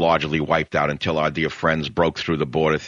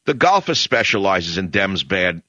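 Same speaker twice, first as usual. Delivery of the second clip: normal talking voice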